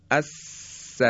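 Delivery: الس with a long unvoiced hissing s; sustained hissing s. Shown as a demonstration. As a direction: hiss the s of الس and keep the air flowing. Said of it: "این سین جریان صوت ادامه داره".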